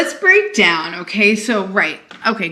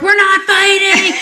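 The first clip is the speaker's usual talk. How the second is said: high, slow mock shout; drawn-out, high-pitched imitation yell; loud and animated; 12 semitones higher, 8 dB louder than usual. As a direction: yell it in comic protest, imitating noisy relatives. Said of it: "really loud and animated".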